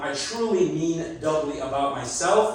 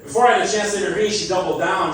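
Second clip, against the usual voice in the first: normal talking voice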